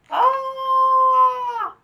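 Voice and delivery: high loud crying yelp; a sudden scare; startled cry. Acted. Frog grabbed by bird, high loud yelp. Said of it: "says the frog".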